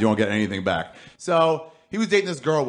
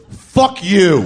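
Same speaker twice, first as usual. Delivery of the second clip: loud, higher mock fury; angry outburst; 7 semitones higher, 8 dB louder than usual. Customer cursing the stranger before hanging up, angry. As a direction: imitate a furious caller, hit both words hard. Customer angrily ends phone call.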